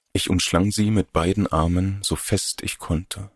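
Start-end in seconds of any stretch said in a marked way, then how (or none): none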